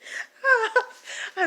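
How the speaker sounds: high-pitched breathless laughing fit; real teary laugh; helpless laughter. Real, not acted. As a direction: laugh until nearly crying. Helpless laughter at the story's end.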